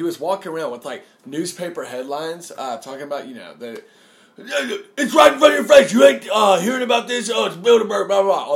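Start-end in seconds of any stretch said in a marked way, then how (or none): 4.4-8.4 loud, high, shouted conspiracy rant; mock a street ranter at full volume, trailing into filler; Alex Jones. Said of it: "Did you ever see the video clip of him".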